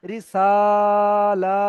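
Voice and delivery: রিসালা with সা and লা held equally long; short রি, then two sustained syllables in রিসালা; two equal elongations. Shown as a demonstration. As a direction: stretch both সা and লা in রিসালা. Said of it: "এই দুটো একই টান হবে".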